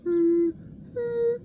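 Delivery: very high hums felt in the lips; high buzzing hums. Shown as a demonstration. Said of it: "feel the buzz in your lips".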